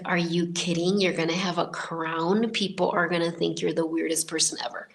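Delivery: ordinary delivery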